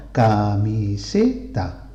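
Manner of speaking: slow, separated beats on "Camiseta"; syllable by syllable. Shown as a demonstration. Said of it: "a esos golpes de voz".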